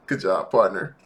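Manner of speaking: ordinary delivery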